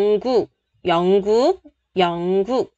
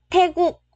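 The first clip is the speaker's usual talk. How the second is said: "Tae" pitched high in "Tae Quốc"; raised pitch; 7 semitones higher than usual. Shown as a demonstration. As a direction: start "Tae" high as a pronunciation model. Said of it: "lên giọng xíu ha".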